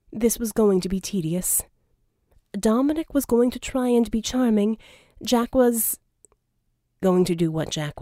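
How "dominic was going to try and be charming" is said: ordinary delivery, nothing marked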